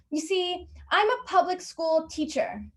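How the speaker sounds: high-pitched entitled complainer act. Acted. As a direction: put on a high, pleading customer voice.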